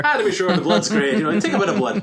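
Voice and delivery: high, loud stage Irish; boisterous cod Irish brogue; mock Irish accent. Co-host joining in mocking Tom Cruise, boisterous mock Irish. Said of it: "thinks they're back and far and away".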